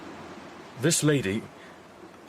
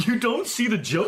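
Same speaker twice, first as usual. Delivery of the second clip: laughing; 8 semitones higher than usual. Acted. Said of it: "I see you are not like yourself".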